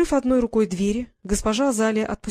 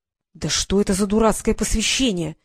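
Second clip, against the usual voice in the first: plain reading voice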